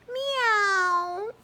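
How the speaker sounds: high, loud meowing cat; a big squeaky meow. A narrator's reading. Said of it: "said the cat".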